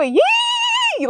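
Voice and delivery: long, high-pitched holler; drawn-out imitation of a catcher's whoop; Wild Man's squeal. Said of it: "he would go".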